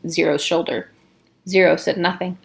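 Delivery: usual speaking voice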